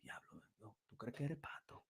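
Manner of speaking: barely audible, nervous whisper; timid whisper. Pretend. Men afraid to speak freely, whispering timidly. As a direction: hide it under your breath. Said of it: "We be like".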